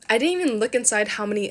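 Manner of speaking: normal talking voice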